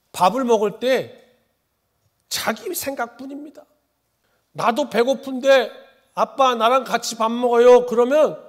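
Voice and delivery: high, loud, measured recital; family dog's complaint. Acted. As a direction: air a grievance as the put-upon family pet. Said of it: "제 이름은 보리입니다". (강아지 입장에서 높고 크게 또박또박 하소연)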